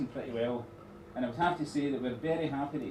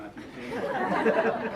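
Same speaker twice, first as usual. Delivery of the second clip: laughing; 8 semitones higher, 7 dB louder, much slower than usual. Real, not acted. Laughs while teasing the groom.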